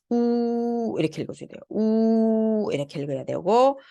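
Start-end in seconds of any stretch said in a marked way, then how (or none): 0.1-1.0 "우" held long with lips pushed out; rounded vowel. Shown as a demonstration. Teacher modelling for students, long "우" with protruded lips. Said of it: "입이 쭉 나와서". 1.7-2.7 long, level "우" through pursed lips; rounded vowel. Shown as a demonstration. Teacher repeating the model sound, "우" long and rounded. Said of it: "입이 쭉 나와서".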